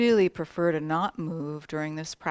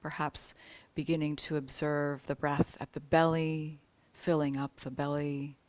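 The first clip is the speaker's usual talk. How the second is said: calm, spacious meditation voice with long pauses; soothing yoga nidra guidance, phrase by phrase; guided meditation. Real, not acted. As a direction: lead them into the breath, unhurried. Leads awareness and breath meditation.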